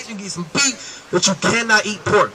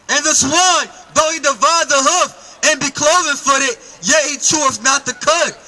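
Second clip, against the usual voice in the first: slow, higher reading of the verse; scripture reading; 4 semitones higher than usual. Acted. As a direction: read it out like a preacher. Reads scripture aloud.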